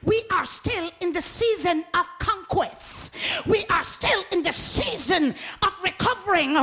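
rising sermon crescendo; building preaching. Acted. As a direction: build from firm to full cry.

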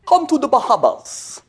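slow, announcer-style ad pitch. Retold or imitated. Preacher mocking a travel ad, smooth announcer voice. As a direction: croon it like a holiday commercial. Mock TV commercial voice.

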